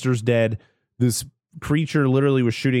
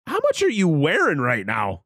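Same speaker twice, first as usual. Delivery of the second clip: incredulous; 9 semitones higher than usual. Retold or imitated. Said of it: "I'm like".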